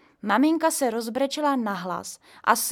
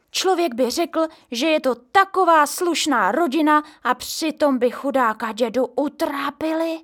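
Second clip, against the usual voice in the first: indignant gossip; 6 semitones higher, 7 dB louder than usual. A narrator's reading. Neighbour gossips.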